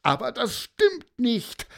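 put-on high, upset child's protest; high-pitched wail of denial; tearful rage. A narrator's reading. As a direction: cry out against the accusation. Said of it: "fing an zu weinen vor Wut".

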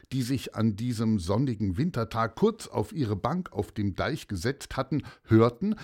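usual speaking voice

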